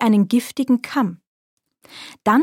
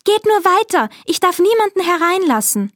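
Schneewittchen; 9 semitones higher than usual. A narrator's reading. Schneewittchen spricht.

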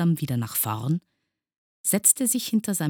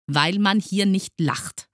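raised, emphatic teacher's answer; stern rebuke; 6 semitones higher, 5 dB louder than usual. A narrator's reading. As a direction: insist sternly on the rule. (streng belehrend)

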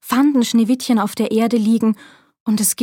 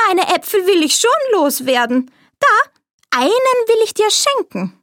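Bäuerin; 10 semitones higher than usual. A narrator's reading. Verkleidete Königin als Bäuerin.

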